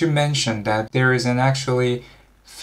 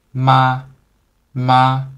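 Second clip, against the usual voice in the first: "ma" level and slightly raised, a little louder; higher first tone; 5 dB louder than usual. Shown as a demonstration. Teacher shows higher voices the first tone, "ma" level and slightly raised. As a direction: hold "ma" steady just above your usual pitch. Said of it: "some of you have a higher voice".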